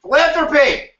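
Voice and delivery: Philanthropy shouted high and loud in triumph; triumphant shout. Shown as a demonstration. Teacher cheering a student who finally says it, shouting. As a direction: yell the word Philanthropy like a winner.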